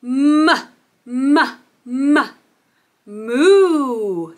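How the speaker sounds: short "M" bursts, then a long "moo"; cow sound broken down. Shown as a demonstration. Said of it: "says the cow".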